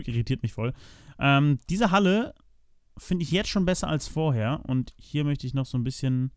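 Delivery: ordinary delivery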